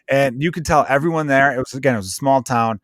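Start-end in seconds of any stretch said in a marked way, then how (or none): none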